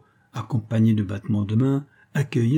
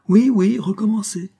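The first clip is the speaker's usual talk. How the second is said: high, loud clamour; crowd chorus; 10 semitones higher, 5 dB louder than usual. A narrator's reading. Whole company insists the trick be repeated, clamouring chorus. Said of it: "toute la société de répéter".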